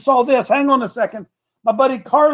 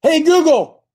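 higher, louder call to the phone; calling voice assistant; 6 semitones higher, 4 dB louder than usual. Real, not acted. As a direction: raise the voice to wake the phone.

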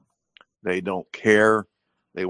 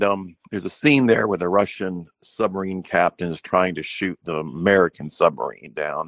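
usual speaking voice

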